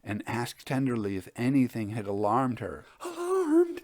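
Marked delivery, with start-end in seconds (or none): 3.0-3.8 high, shaken echo of the question; wild. A narrator's reading. Elder sister wildly answers her sister's gentle question. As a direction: toss the word back, still half in the dream. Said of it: "she answered, still very wildly".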